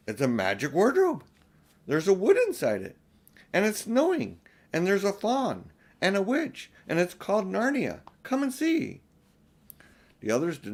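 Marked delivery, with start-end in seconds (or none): 0.1-9.0 higher, bubbling with wonder; Lucy urging her siblings to come see; excited girl. Acted. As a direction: rush to share a secret. Lucy eagerly describing Narnia.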